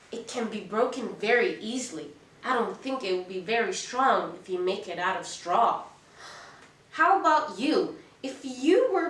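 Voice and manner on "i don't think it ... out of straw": plain reading voice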